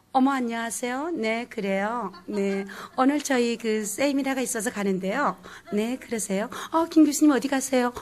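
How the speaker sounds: 서울 말투 흉내; Seoul standard speech. Retold or imitated. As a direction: mimic polite Seoul speech. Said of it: "이 서울 말은 굉장히 빨라요".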